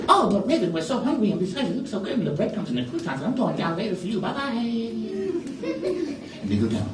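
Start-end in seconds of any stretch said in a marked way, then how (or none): 0.1-4.5 high, breezy bird voices; carefree birds. Acted. Birds brushing off raven's warning, high and giddy. 4.6-6.4 high giggling bird laugh; giddy bird laugh. Acted. Bird laughing on the way down, high giggle.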